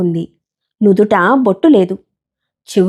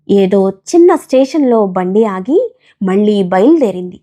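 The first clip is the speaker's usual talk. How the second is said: usual speaking voice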